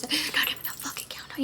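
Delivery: fast urgent whisper. Retold or imitated. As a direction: hiss it out under breath. Whispered aside retelling demand.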